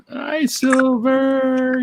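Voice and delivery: high, slow drawn-out hello; sing-song greeting. Real, not acted.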